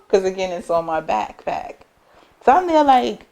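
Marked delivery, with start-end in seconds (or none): none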